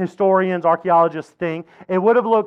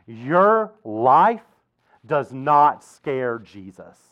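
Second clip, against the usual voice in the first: weighty phrases with long pauses; slow right down and let each word land; slow and deliberate; 6 semitones lower, much slower than usual. Real, not acted. Preacher reassures congregation about their lives, slow and deliberate.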